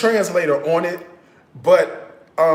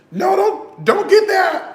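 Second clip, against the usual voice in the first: high warning cry; 5 dB louder than usual. Real, not acted. Tasted fake calamansi juice.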